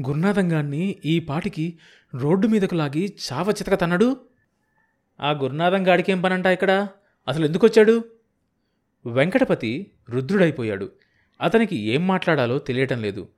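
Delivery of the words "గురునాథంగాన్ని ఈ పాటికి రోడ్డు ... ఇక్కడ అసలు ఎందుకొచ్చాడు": rising outrage, higher and louder; building from bitter to shrill fury; indignant wife. Acted. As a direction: she grows angrier with each line. Wife vents about Gurunatham.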